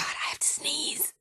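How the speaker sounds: urgent whisper, fighting a sneeze. Acted. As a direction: whisper it, trying not to sneeze. Holding in a sneeze, hiding.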